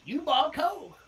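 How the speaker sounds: a hint of a clueless kid.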